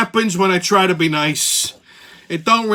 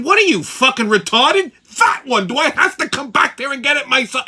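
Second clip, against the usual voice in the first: higher and louder, exasperated; retold angry snap; 8 semitones higher, 4 dB louder than usual. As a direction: customer yelling at the clerk. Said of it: "I'm like, I said".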